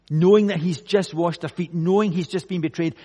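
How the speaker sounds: loud, driving sermon climax; impassioned preaching. Real, not acted. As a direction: preach it with force, then stretch out the commandment. Preaching builds to the key quote.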